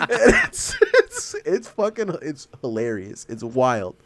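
ordinary delivery

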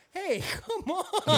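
high, drawn-out protest; retold plea to back off; exasperated call-out. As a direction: stretch it out high, half laughing. Protest to a catcaller, acted out.

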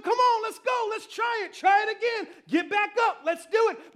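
loud, high rallying cries; fired-up acted encouragement; cheering on. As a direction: urge them like a crowd of supporters. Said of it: "And they're going".

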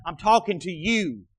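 ordinary delivery

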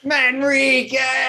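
slow, high drawn-out call; mock yelling. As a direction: call his name out long and high. Yelling for friend lost overboard.

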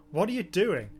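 ordinary delivery

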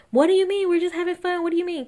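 high, pushy mock whine; sarcastic impression of a pushy friend pestering; mocking Drew. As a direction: needle her in a high, insistent whine. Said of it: "Kenya asked repeatedly".

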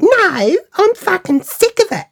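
high-pitched fed-up retort; high, indignant refusal; imitated angry outburst. Angry woman refuses friend's calming.

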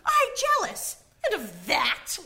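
very high, loud, near a cry; indignant outburst. Acted. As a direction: scoff in outrage. Wife scoffing at jealousy.

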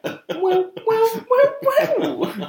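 high, laughing, teasing well well well; hammed-up knowing tease. Teasing about office flirting.

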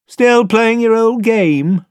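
put-on high, slow, loud sneering boy; Eustace grinning taunt. A narrator's reading. Eustace barging in to mock his cousins, high sneer. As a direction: taunt them, drawn out and mocking. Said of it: "came grinning into the room".

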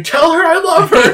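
high, loud, slow mock scream. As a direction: bellow it desperately at the medium. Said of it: "Ray screaming".

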